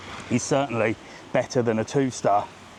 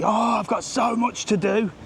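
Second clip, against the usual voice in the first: higher, drawn-out mock complaint; slow, put-upon lament in a raised pitch; mock moaning; 7 semitones higher, slower than usual. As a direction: imitate an overwhelmed moan about chores. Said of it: "You women all piss and moan about your chores".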